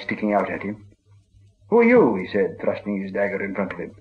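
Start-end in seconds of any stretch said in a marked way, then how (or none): 1.7-2.2 high, louder startled cry; startled challenge. A narrator's reading. Bilbo startled in the dark, crying out high and loud. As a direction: jump back and demand who's there.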